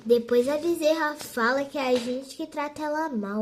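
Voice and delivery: usual speaking voice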